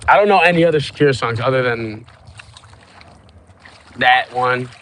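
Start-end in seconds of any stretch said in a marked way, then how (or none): none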